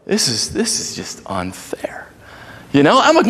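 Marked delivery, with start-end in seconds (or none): 0.1-2.1 mock whisper, low and slow; whispered complaint. Dad whispering to himself at the pool, mock unfair. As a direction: grumble it under your breath. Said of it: "I'm right away like".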